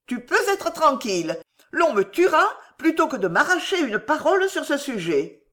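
high-pitched, emotional plea; imploring. A narrator's reading. Woman reassuring him with hands clasped, imploring. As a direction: plead from a throat tight with emotion. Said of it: "elle murmura en joignant les mains dans un geste implorant".

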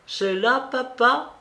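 put-on high, small child's voice; lisping little girl. A narrator's reading. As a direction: ask like a babbling little girl. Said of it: "marmotta la petite en grasseyant".

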